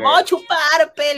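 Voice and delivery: high, loud teasing voice quoting her; mock Peruvian slang. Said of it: "le decías tú". (imitación burlona, aguda y fuerte)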